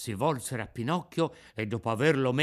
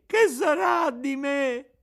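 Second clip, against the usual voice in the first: much higher, louder, slow bawling; howling wail; 13 semitones higher, 7 dB louder, slower than usual. A narrator's reading. Pinocchio wails his fear at the top of his voice. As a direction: let the cry break into a howl. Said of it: "continuava a piangere, a berciare".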